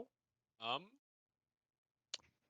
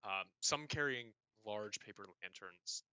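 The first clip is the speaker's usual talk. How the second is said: game master's scene setting; 3 semitones lower than usual. A narrator's reading. Game master describing the festival at the palace, hushed narration.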